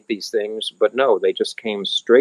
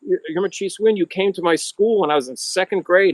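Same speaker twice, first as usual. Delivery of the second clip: excited fan; 9 semitones higher than usual. Retold or imitated. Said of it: "he goes".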